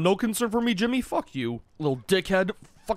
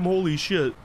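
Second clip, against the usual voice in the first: normal talking voice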